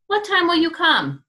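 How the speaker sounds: bright curious little pig. Acted. Little pig asks wolf.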